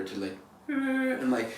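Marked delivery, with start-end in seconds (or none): 0.7-1.1 high-pitched mock brr, bright and light. Shown as a demonstration. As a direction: flip the grumble up into a high happy trill. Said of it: "changed the nature of my frequency".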